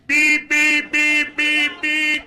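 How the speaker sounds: loud, high repeated horn blasts; mimicked car horn, steady high note; imitated car honking. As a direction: honk like an impatient driver. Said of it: "he's honking just at me".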